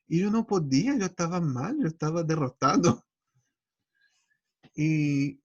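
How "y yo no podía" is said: usual speaking voice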